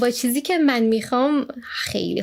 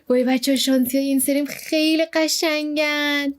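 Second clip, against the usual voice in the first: normal talking voice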